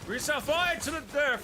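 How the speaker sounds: loud outburst. Real, not acted. Sudden outburst in game.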